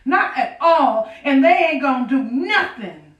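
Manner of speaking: high, loud anguished anger; grieving fury. Acted. Widow rails at God over her husband killed by another slave, high and loud. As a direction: let the grief spill into rage.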